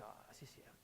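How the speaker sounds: breathy whisper of agreement; whispering a small admission; whispered concession. Retold or imitated. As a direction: concede it under your breath. Quietly conceding to the video.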